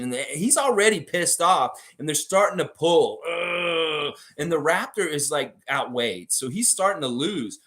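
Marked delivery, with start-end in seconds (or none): none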